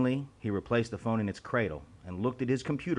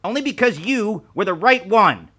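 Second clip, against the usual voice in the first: louder, firm, stress on "right"; forceful insistence; 11 semitones higher, 13 dB louder than usual. A narrator's reading. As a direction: press the point hard. Boss insists over the phone.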